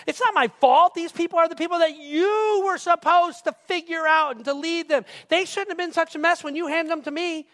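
loud, high, indignant self-defence; blame-shifting. Acted. Aaron defends himself to Moses after the calf, blame-shifting. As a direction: throw the fault back at him forcefully. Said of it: "So he shifts the whole blame".

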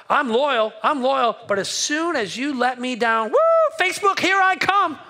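mock fickle friend. Mocking fickle loyal person.

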